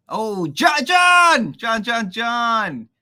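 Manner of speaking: high, loud repeated calling out; excited shout. Real, not acted. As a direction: call his name across the room. Host greets arriving guest John.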